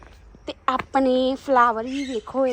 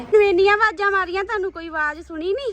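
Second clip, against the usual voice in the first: higher, louder, fast complaint; exasperated raised voice; 8 semitones higher, 6 dB louder, faster than usual. Real, not acted. Complaining to her brother that he ignored her calls, raised and fast. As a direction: call out at him, annoyed he didn't hear.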